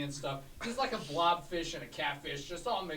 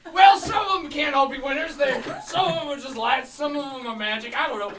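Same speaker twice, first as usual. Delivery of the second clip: potion seller; 11 semitones higher, 12 dB louder than usual. Acted.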